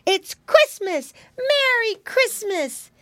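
high piglet shouting joyfully; excited cry. A narrator's reading. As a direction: squeal it to the whole house. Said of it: "he cried".

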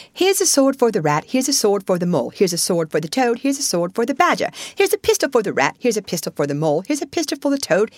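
fast, high-pitched busy patter; excited muttering. Acted. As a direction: rattle off the list without stopping, full voice kept. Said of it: "saying excitedly under his breath".

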